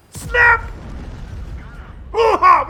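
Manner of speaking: very high, loud celebratory hoot; excited whoop. Real, not acted. Commentator whooping at the big hit. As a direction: let out a hooting cheer.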